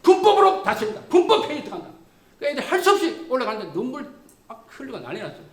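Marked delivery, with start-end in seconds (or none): 0.0-1.9 sharp, high commanding shout; a raised officer's command; shouted order. Retold or imitated. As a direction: bark the order at the troops. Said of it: "소리를 쳤어요".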